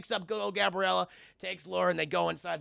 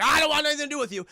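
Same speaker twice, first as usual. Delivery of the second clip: fast, high, loud mock refusal; fast angry brush-off; 10 semitones higher, 7 dB louder, much faster than usual. Logan brushing off the lady, fast and loud. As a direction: rattle it off to get rid of her. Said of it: "And he's like".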